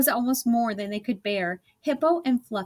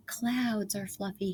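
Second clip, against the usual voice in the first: soft, slow musing; quietly turning over an idea; soft, slow inner thought; thinking aloud; 6 dB quieter than usual. A narrator's reading. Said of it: "he thought".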